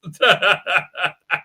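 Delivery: real staccato chuckle; laughter. Real, not acted. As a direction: run of short chuckles. Laughs before sharing screen.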